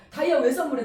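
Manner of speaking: normal talking voice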